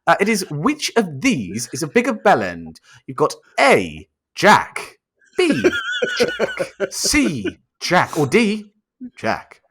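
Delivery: lightly put-on quiz-host delivery; measured mock quiz question; Chris Tarrant. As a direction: read out the options like a game-show host. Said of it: "It's Chris Tarrant here".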